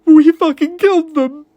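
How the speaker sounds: high, loud, sobbing cry; sobbed. A narrator's reading. David collapsing and sobbing before his co-workers. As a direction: break down sobbing on the confession. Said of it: "he sobbed".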